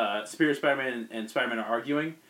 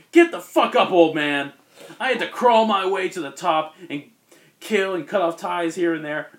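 loud, much higher, sneering tirade; arrogant yelling; 4 semitones higher, 7 dB louder than usual. Retold or imitated. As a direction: bark it at the old man with contempt. Arrogant Superior Spider-Man berates Ben.

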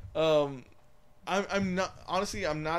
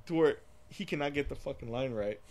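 ordinary talk, nothing marked

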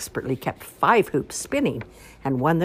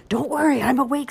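put-on high eager boy voice; little boy; 11 semitones higher, 4 dB louder than usual. Ian reassuring his sister from the doorway, eager. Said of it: "said Ian".

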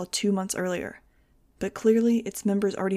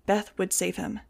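ordinary delivery

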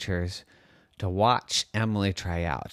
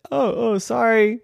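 high, sheepish mom apology; apologetic mommy; 11 semitones higher, 5 dB louder than usual. A narrator's reading. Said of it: "And Mommy said".

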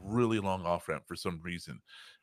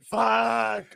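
high relieved laugh and curse; 14 semitones higher, 5 dB louder than usual. Retold or imitated. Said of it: "I'm just like".

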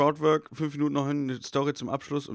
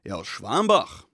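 proud and matter-of-fact; 4 semitones lower, 4 dB louder, slower than usual. Retold or imitated. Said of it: "sagte so voller Stolz, so als wär's ganz klar".

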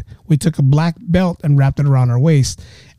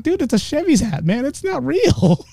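high, soft protest; hammed-up defensive whine; mock pleading; 9 semitones higher, 4 dB quieter than usual. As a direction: plead your innocence in a high voice. Acting his defence to the challenger.

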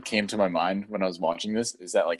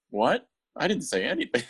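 higher, playful mock innocence; mock innocent Mina; 7 semitones higher than usual. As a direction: play dumb in a raised voice. Mina denies speaking.

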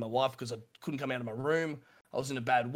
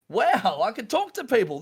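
louder, higher burst of delight; amazed wow; 7 semitones higher, 7 dB louder than usual. Retold or imitated. Telling his friend how thrilled he was, a loud amazed wow. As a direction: gush with sudden discovery.

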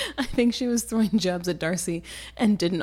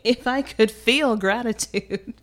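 slow, deliberate, louder opening; indignant refusal; 3 semitones higher, 5 dB louder, slower than usual. Acted. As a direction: deliver a weighty, acted rejection. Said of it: "the blood rises to her cheeks".

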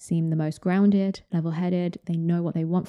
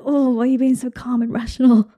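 higher, louder exasperated whine; frustrated outburst; 6 semitones higher, 6 dB louder than usual. Retold or imitated. Stressed person exasperated at a calm one, loud and high. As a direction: vent at someone who won't lose their cool. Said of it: "it's like".

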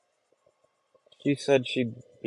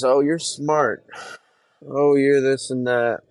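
slow, higher, louder sing-song mock of praise; 5 semitones higher, 7 dB louder, slower than usual. Mimicking people who always call him smart, a loud mocking sing-song. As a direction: parrot their praise back with a sneer. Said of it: "They always expect me".

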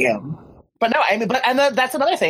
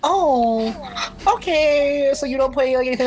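let-down, pitched up; disappointed high pitch; 9 semitones higher, slower than usual. Retold or imitated. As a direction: sound deflated but polite. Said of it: "And I was like".

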